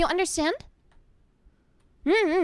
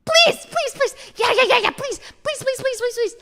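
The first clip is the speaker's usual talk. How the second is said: begging please; 8 semitones higher than usual. Shown as a demonstration. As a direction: beg in a tiny high squeal. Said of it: "can I hear that?".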